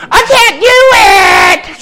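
mock wailing cry of self-pity, high and slow; whiny complaint. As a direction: wail it like a spoiled complainer. Mocking a complaining inmate.

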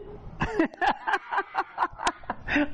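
breathy high-pitched laugh. Real, not acted. Laughing at the joke.

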